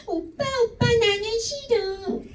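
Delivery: high, slow whiny aegyo plea; clingy girlfriend pleading with her boyfriend, high whiny aegyo; cutesy girlfriend. Pretend.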